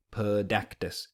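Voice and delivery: Pedactus said slowly with a clear D for the R; Pedactus, D in place of the R. Shown as a demonstration. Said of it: "a P D sound at the beginning".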